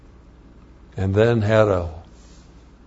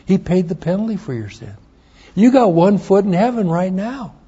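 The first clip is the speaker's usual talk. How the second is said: much higher, louder, pressing the good news; fervent urging; 9 semitones higher, 3 dB louder than usual. Retold or imitated. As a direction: lift him with hope. Son urging father to believe.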